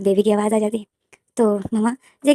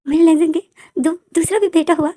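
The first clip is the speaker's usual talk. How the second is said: shocked; 7 semitones higher than usual. Acted. Mother stunned by a second grandson, high and astonished. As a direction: gasp it out in disbelief. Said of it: "shock में हो जाती है".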